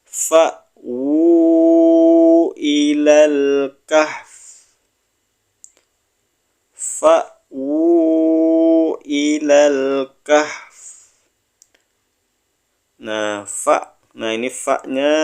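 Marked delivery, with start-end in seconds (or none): 0.0-10.8 slow chanted Arabic; Yu'jibuk recited slowly, final kaf breathed out; Quran recitation. Shown as a demonstration. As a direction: intone it like a tajwid teacher. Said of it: "Kita pindah ke sebelahnya".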